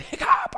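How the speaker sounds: sudden mock hiccup; imitated hiccup. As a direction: jerk out a sharp hiccup. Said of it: "I hiccuped again".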